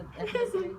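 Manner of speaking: high, sarcastic question; mock the trendy word. Mocking trendy term.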